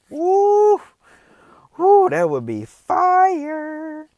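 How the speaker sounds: high, loud, excited whoop. Real, not acted. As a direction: cheer it out, thrilled. Excited about claw variations.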